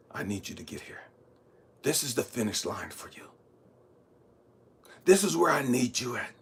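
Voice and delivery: low, hushed voice of God; God instructing. Retold or imitated. God showing Elijah his finish line, low and soft. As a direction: instruct softly and calmly. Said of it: "he's telling me to get over here".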